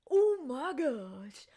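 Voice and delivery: slow, loud, drawn-out gasp of words; an overdone stunned outcry; stunned Haymitch. Retold or imitated. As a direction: stretch each word out in shock. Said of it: "and goes".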